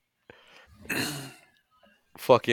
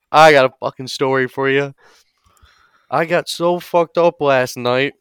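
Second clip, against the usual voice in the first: cocky Boston bro bragging, slowing down; Boston Guido voice; 4 semitones higher than usual. Retold or imitated. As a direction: brag like a drunk Southie bro. Boston Guido brags to group.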